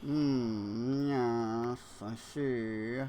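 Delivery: a hint of a smug cartoon villain musing; Fat Cat villain voice. Gamer musing at his build in a mild cartoon villain voice. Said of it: "old cartoon villain voice".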